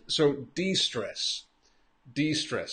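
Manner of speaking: de-stress said slowly with stress on stress. Shown as a demonstration. Teacher modelling de-stress for a learner. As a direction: spell out de-stress, slow and clear. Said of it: "the pronunciation de-stress".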